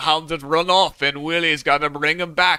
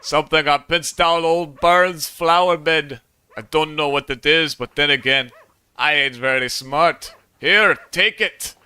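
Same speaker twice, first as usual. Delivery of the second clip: put-on Scottish accent; 3 semitones higher than usual. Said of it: "You're going to do the Scottish accent".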